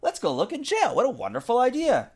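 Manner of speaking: high, gleeful Sheila; thrilled girl. Acted. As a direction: gush with mischievous delight. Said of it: "yelled Sheila".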